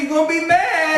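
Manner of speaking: mock southern motel clerk voice, barely holding back a laugh; southern accent. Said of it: "So I try to use a southern ac-, accent".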